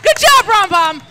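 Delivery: loud high praise shout; cheering shout. Real, not acted. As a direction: cheer the students on. Praising marchers.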